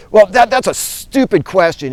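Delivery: high, loud, mocking put-on voice of a heckler; scornful man. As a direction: scoff at the question as if it's beneath you. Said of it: "And the man's like".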